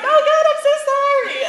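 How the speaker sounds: high, loud flustered sorry; flustered apology. Retold or imitated. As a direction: blurt the apology out in alarm. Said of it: "and I was like".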